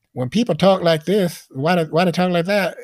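mock high-pitched talker, loud and far above natural pitch; weird high voice. Shown as a demonstration. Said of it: "weird little voice pitch".

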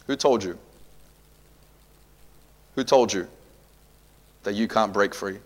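ordinary talk, nothing marked

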